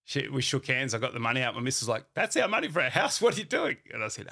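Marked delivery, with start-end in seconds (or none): 2.1-3.8 high, loud, alarmed protest; wife's outburst. Retold or imitated. Wife confronts the speaker over house money, alarmed loud protest.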